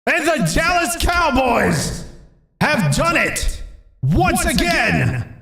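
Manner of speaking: high, slow booming play-by-play call; sports announcer shout. Pretend. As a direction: call it like a championship win on TV. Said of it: "It's like Dallas just won the Super Bowl".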